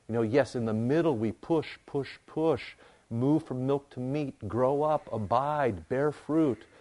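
rally the flock, voice lifted and ringing; preaching at full force. Real, not acted. Pastor urging his people to grow up in faith, preaching loud and high.

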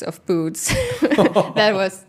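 laughing through the words. Real, not acted. Guest recalling the shoe fair, laughing. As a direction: talk through a laugh, amused.

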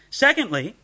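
ordinary talk, nothing marked

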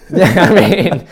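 laughing through the words, slowed; amused laugh spilling into the reply. Real, not acted. Host reacting to the guest losing his accent, laughing.

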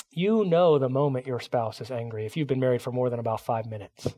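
normal talking voice

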